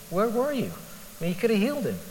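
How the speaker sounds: high-pitched retold challenge; mock bold reproach. Preacher voicing the sisters' bold reproach to Jesus. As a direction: imitate a bold complaint, voice swooping up. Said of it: "it all just seems rather bold".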